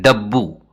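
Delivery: डब्बू said carefully with a doubled b; Telugu word said. Shown as a demonstration. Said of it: "डब्बू इस तरह कहते हैं".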